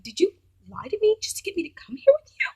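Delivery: whispered, pointed question; hushed accusation. Retold or imitated. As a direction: whisper it sharply. Accusing the boyfriend.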